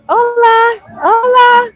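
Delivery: high, loud singsong greeting; playful hola. Pretend. Vlogger breaking off to greet someone, high singsong. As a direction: chirp it like a cheery hello.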